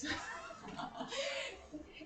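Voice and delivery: breathless soft giggle; soft airy laugh. Real, not acted. Host giggling at co-host's mock disclaimer. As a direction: let out a quiet airy laugh.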